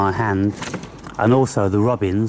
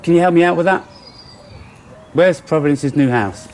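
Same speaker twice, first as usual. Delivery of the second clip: playful talk to pets; 6 semitones higher than usual. Real, not acted. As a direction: ask the squirrel playfully. Asking the squirrels playfully.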